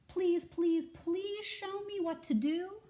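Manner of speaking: eager squirrel pleading with the goat to teach him; begging squirrel. Acted. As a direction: beg like your life depends on it. Jules begs the goat.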